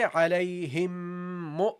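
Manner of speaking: "Alayhim mu" slow, the m held into mu; slow nasal link. Shown as a demonstration. Teacher links the words for students, slow. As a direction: hum the m into "mu". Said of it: "So you say".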